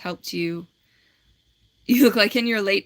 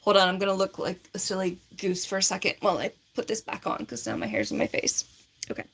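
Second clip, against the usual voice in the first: ordinary delivery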